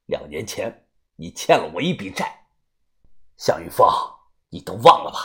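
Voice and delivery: low, menacing avenger's voice; vengeful beggar. Acted. Head-bowed beggar demanding a debt of blood, menacing. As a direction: speak low and measured, like someone back from the dead.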